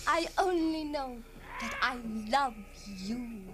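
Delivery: soft, slow love confession; tender. Acted. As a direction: confess it gently.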